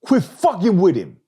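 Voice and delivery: high, loud shouted heckle; heckler shout. Retold or imitated. Heckler shouting at the comic from the back row. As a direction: yell it from the back of the room. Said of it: "Somebody in the back said".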